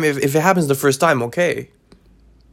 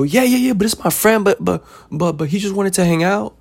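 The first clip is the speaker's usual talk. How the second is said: put-on much higher, whiny kid making excuses; 7 semitones higher than usual. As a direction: plead like a kid caught out, voice pitched way up. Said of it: "But then you go".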